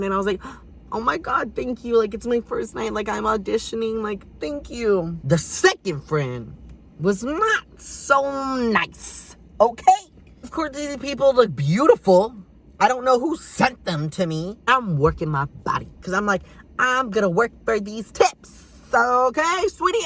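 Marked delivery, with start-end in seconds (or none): 0.9-5.2 high-pitched, grateful gushing; lightly acted flustered thanks; flattered gushing. New dancer thanking a tipper, flattered gushing. 16.8-20.1 high-pitched, sassy declaration; hammed-up diva sass. Dancer working for tips, sassy.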